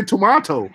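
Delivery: high, emphatic "tomato" with ah in the middle; imitated British pronunciation. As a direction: question the British "tomato" with a long ah. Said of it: "why do you say".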